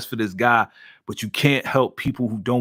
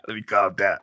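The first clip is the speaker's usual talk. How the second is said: usual speaking voice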